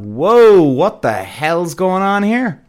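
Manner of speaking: loud high whoa, then drawn-out question; stunned disbelief. Retold or imitated. Podcaster reacting to sudden social media backlash, stunned disbelief. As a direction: act out being floored, stretching the words.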